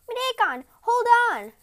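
high, loud urgent cry; urgent call. Acted. Tanabata calling down to her husband, urgent loud cry. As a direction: call down to him fast.

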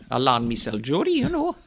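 Geordie accent. Shown as a demonstration. Showing the interviewer the local accent, broad Geordie. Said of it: "I can speak the local accent".

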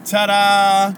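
high, loud drawn-out ta-da; showy reveal. Acted. Preacher unveiling the morning miracle to his listeners, showy reveal. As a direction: present it with a mock-magician flourish.

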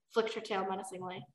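plain reading voice

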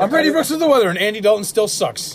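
loud, high anchor voice cracking into laughter; hammed-up weatherman, laughing through it; laughing news anchor. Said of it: "Sounds like a good news anchor".

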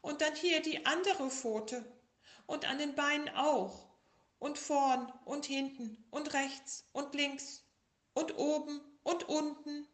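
high, loud, slowing whine with a laugh at the end; sick little tiger. Acted. Tiger lists all his aches to bear, high and whiny. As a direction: pile on every ache, milking it. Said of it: "sagte der kleine Tiger".

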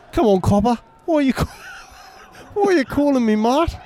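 put-on Australian accent. Australian farmer role.

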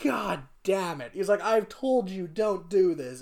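the Emperor scolding his stubborn son; mock exasperated scolding. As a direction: scold like a fed-up dad.

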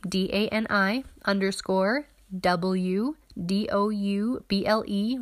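careful letter-by-letter spelling. Shown as a demonstration. Spelling out her Instagram handle.

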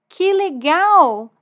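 loud, high, very drawn-out delight; big stretched-out squeal of wonder; thrilled boy. Acted. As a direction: marvel like an amazed child. Boy delighted the dragon talks.

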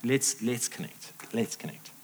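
low, quiet, conciliatory; soft apology. Acted. Spouse apologising to partner and reconnecting, tender.

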